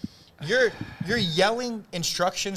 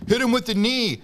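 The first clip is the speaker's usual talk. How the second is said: louder, higher mock sideline coaching; fan's yell; 9 semitones higher, 6 dB louder than usual. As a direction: imitate a spectator shouting instructions. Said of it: "But for you to yell out".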